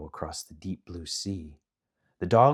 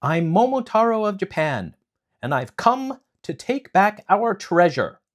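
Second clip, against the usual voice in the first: high, loud proud declaration; bold hero; 12 semitones higher, 8 dB louder than usual. Acted. Momotaro answers the demon king, loud and proud. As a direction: stand tall and announce yourself.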